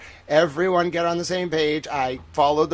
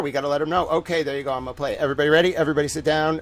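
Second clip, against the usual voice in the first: ordinary delivery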